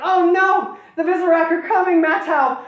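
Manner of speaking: high, loud hammed-up wailing; horrible wails. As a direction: wail for rescue in a mock damsel voice. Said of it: "horrible wails".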